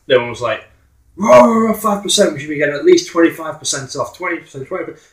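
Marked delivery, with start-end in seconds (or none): 1.2-5.1 crowd voiced high and loud; mock crowd shouting. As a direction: shout out numbers like an angry crowd. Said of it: "Just numbers being shouted out".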